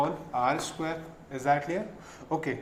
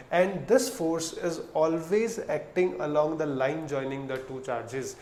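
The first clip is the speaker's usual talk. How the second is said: very slow, word by word; slow dictation; much slower than usual. Shown as a demonstration. Teacher repeating the law slowly for note-taking. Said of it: "मैं आराम से धीरे धीरे बोल रहा हूँ".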